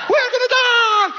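high, loud, drawn-out terrified yell; panicked scream. Retold or imitated. Friend Ralph screaming as the car loses its brakes, panicked. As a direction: scream it like the brakes just failed. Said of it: "He was yellow screaming".

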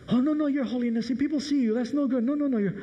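high, frantic pleading of a nervous Italian driver; upset Italian chauffeur. Acted. Chauffeur begs the Pope to keep the windows up, high and frantic. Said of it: "the Italian chauffeur gets very upset".

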